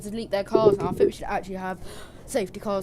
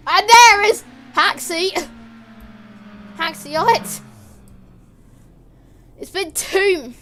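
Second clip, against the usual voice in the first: shouted, much higher and louder play-by-play; excited crash commentary; 7 semitones higher, 11 dB louder than usual. Acted. Commentator reacting to a crash on track, shouting. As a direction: call the crash like breaking news.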